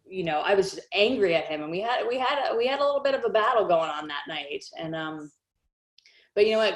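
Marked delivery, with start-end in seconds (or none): none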